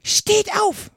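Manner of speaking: very high, piercing queen's order; piercing cry. A narrator's reading. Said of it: "schrie die Königin mit durchdringender Stimme". (durchdringender, hoher Schrei)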